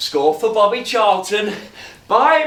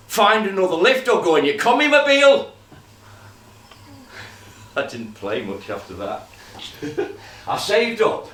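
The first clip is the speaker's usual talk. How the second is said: ordinary delivery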